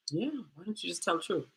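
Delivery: soft, unruffled retold reply; calm reply. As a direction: answer the squeal quietly, as the calm friend. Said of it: "very calmly was like".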